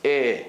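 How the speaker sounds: one mid "eh" between eh and ay; pure "eh" with no glide; Israeli vowel. Shown as a demonstration. Teacher showing what Israelis say, single mid eh. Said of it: "The Israelis say eh".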